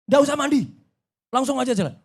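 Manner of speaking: high, curt imitated order; perintah tegas. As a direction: imitate an officer refusing a request. Petugas melarang mandi, dikutip. (meniru perintah petugas, nada tinggi)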